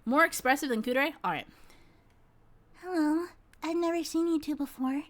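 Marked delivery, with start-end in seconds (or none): 2.8-5.0 cute little creature voice, pitched up; high-pitched baby creature. Acted. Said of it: "like a high-pitched voice baby".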